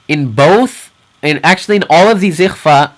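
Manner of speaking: ordinary delivery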